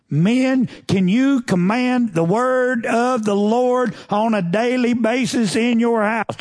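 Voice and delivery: loud, high, slow challenge to the men; preaching at full force. Real, not acted. Preacher challenging the men of the church, loud and high. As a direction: call the men out, pressing each word.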